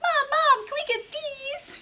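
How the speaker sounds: put-on high little-kid voice, begging; pleading child. Jasper begging Mom at the checkout, high and pleading. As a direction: plead like an eager small boy. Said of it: "Jasper pleaded".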